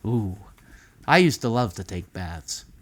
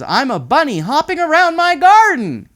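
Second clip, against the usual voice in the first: put-on high, loud playful bunny kid; boy pretending to be a bunny; bouncy kid voice; 10 dB louder than usual. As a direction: hop through it like a happy bunny. Child voiced in picture story.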